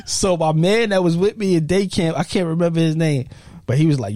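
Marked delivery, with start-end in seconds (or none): none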